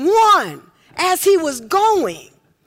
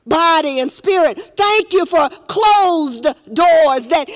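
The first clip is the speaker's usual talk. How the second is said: fervent prayer, steady and building. Acted. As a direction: pray over the congregation with rising fervour. Closing prayer.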